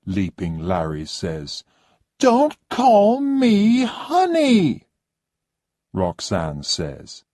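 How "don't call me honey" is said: much higher and louder, a demanding whine; haughty high-pitched complaining lady; spoilt rich guest. Acted. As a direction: play the pampered diva who expects service. Roxanne snaps at husband.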